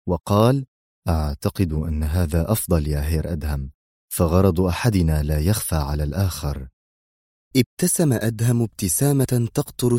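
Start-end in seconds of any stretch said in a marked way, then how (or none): 1.1-6.7 low, lightly voiced villain; villain ignoring mockery. A narrator's reading. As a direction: answer coolly, unmoved by the jibe. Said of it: "تجاهل شامير عبارة أدهم الساخرة". (صوت شرير منخفض يتجاهل السخرية)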